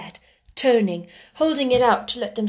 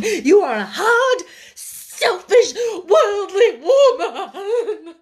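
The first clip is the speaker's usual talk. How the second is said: high, loud, sobbing tirade; overwrought, gasping outburst; laughing and crying hysterically; 8 semitones higher, 6 dB louder than usual. A narrator's reading. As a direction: rant through hysterical tears to a long wail. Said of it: "began to laugh and cry at once".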